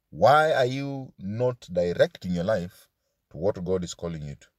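normal talking voice

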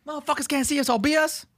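high-pitched rapper voice reciting the lyric. Retold or imitated. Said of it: "The most aggressive line in any hip-hop scene".

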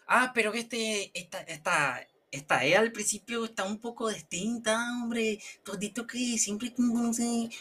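high, soft, plaintive objection; whining student. Acted. Confused student objecting to the teacher, whining. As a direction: complain in a small high voice.

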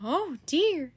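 put-on Baby frets over her sister's plan; fretful Baby voice. Baby dismayed by her sister, worried voice.

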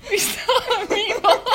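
giggling through the words, high and loud; can't get it out for laughing. Real, not acted. Retelling the meatball story, laughing hard.

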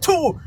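a sharp, high sneeze burst; playful imitation of a big sneeze; imitated sneeze. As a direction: spit out a mock sneeze. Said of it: "he sneezes, like".

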